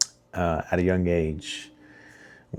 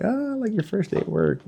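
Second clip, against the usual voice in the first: much higher, cheery mock dad voice; happy dad. Dad honking at his son, mock-cheerful and high. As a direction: imitate a proud, beaming father. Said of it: "like, all happy".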